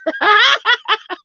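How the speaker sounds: high burst of laughter. Real, not acted. Laughing after Scorpio remark.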